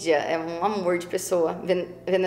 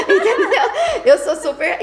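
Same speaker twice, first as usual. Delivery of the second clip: high, louder laughing words; 10 semitones higher, 7 dB louder than usual. Real, not acted. Laughing to the host after imitating her prayers. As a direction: laugh through the question.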